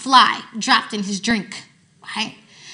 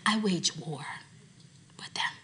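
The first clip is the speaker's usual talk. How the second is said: hushed whispered warning; 5 semitones lower, 14 dB quieter than usual. Shown as a demonstration. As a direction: breathe the words, voiceless. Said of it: "repeated as a whisper".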